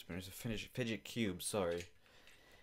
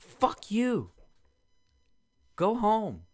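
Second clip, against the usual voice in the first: much higher, much louder, slow furious yell; angry retort; 12 semitones higher, 10 dB louder, much slower than usual. Retold or imitated. Telling off the dog owner, furious yell. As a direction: yell it back at her.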